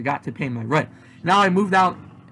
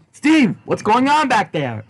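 calling out; 7 semitones higher, 4 dB louder, slower than usual. Acted. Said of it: "I yelled".

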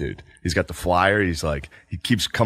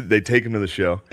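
ordinary delivery

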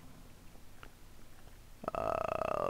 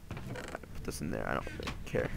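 lower, softer whispered aside; whispering; 13 semitones lower, 14 dB quieter than usual. Real, not acted. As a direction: mutter it under your breath. Unvoiced low aside.

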